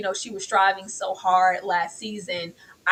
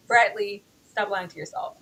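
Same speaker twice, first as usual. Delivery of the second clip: normal talking voice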